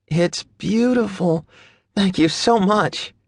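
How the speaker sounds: high, slow, dreamy thanks. A narrator's reading. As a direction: breathe out the thanks, delighted. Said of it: "she looks at it dreamily".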